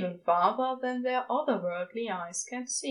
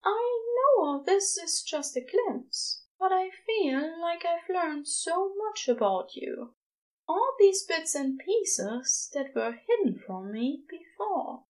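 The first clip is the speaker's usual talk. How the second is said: higher, heartfelt angel voice; tender angel; 8 semitones higher than usual. Acted. Angel tells Crowley he learned so much, higher and tender. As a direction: let the gratitude show, gently.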